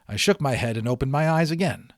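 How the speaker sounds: plain reading voice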